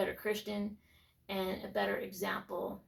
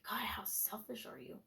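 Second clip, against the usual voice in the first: whispered, self-reproaching thought; inner whisper; 9 dB quieter than usual. Retold or imitated. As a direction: hiss it softly to yourself.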